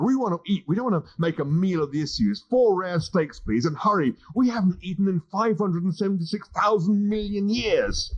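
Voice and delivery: lightly voiced swaggering alien; brash Zaphod. Zaphod character voice.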